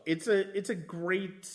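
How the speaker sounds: ordinary talk, nothing marked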